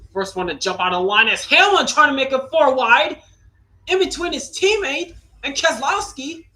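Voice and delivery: calling the four wide breathlessly; excited race call. Real, not acted. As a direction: pitch climbing fast on the restart.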